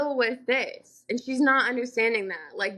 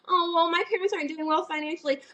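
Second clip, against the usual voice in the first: higher, quicker mock excuse; mocking imitation; 6 semitones higher, faster than usual. Mimicking the other woman's money excuse in a rant, mocking imitation. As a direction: mimic a whiny excuse dismissively.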